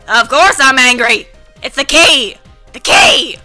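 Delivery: loud, angry scolding grandma; rowdy grandma. Acted. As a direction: blow up at the forgetful grandson. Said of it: "rowdy grandma voices".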